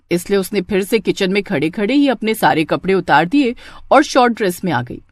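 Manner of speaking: plain reading voice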